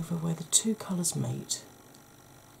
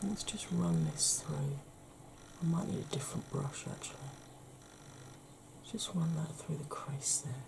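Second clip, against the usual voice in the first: soft unvoiced whisper; ASMR whisper; 10 dB quieter than usual. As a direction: whisper gently to the camera. ASMR makeup tutorial.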